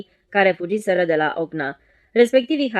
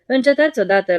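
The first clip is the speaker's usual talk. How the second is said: a furious order to cease fire; yelling across the deck to stop; shouting; 6 semitones higher, 4 dB louder than usual. A narrator's reading. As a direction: shout it out, higher and louder. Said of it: "strigând".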